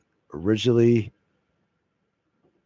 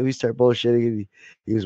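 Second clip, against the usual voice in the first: ordinary talk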